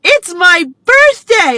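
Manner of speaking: put-on high, loud, slow child's announcement; proud little girl. Little girl announcing her birthday to a stranger, high loud child voice. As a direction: shout it like a proud five-year-old. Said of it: "she's like".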